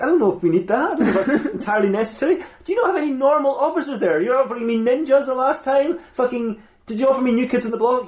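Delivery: high-pitched, incredulous protest; incredulous objection. Acted. Sheriff queried over sending Transformers.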